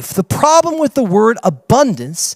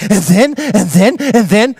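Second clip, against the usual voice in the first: excited babble; 3 semitones higher, slower than usual. Shown as a demonstration. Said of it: "when you get so excited, like, words don't make sense".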